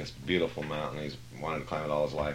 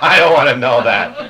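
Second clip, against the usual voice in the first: mock protest; 9 semitones higher, 22 dB louder than usual. Real, not acted. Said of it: "You'll like it".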